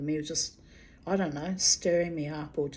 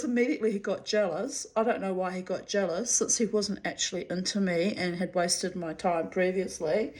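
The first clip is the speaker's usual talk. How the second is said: usual speaking voice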